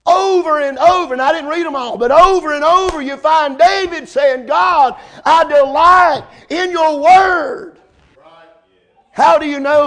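preaching at full force. Acted. Preacher shouting David's words.

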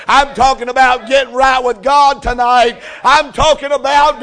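rising to a shouted altar call; preaching build. Acted. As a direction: drive the question home like a revival preacher.

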